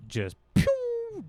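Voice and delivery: high, squeaky "pew" gun noise; quick playful pew of a shot; imitated gunshot. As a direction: toss off a cartoon gunshot sound. Fleeing from a gunman.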